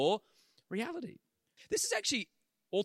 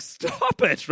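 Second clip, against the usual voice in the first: startled protest; 6 dB louder than usual. Retold or imitated. Accuses old lady next to him.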